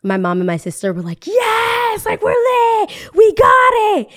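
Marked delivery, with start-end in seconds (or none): 1.3-4.1 high, loud, slow excited cheer; excited shout. Retold or imitated. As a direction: mom and sister celebrating. Mom and sister celebrating.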